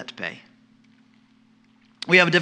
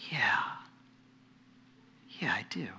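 soft whispered agreement; quiet heartfelt whisper answering God; whispered yes; 5 semitones lower, 13 dB quieter than usual. Acted. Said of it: "in our hearts we say".

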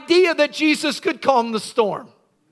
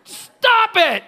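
cranky scolding; 10 semitones higher than usual. Acted. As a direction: yell at it like a grumpy woken sleeper. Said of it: "So he yelled at the storm".

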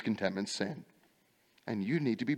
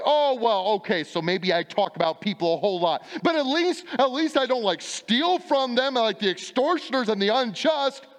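high, louder mock whine of a gossip excusing herself; mock excuse; 8 semitones higher, 5 dB louder than usual. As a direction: plead your case in a put-on whiny voice. Gossip's excuse voiced by preacher.

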